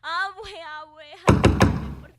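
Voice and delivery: loud, drawn-out cry for grandma; desperate call. Acted. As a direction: call out for help in panic. Girl calling grandmother.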